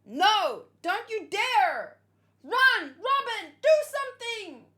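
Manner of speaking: loud, high, urgent yelling; shouting at screen. Real, not acted. Reactor screaming at the screen to warn Robin.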